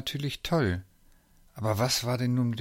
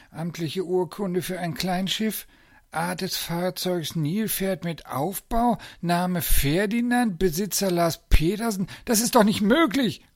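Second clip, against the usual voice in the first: high voice reading out, building louder; mounting disbelief; 8 semitones higher, 4 dB louder than usual. A narrator's reading. Zoo director reads the ship's certificate, disbelieving. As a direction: read it out towards an outraged burst.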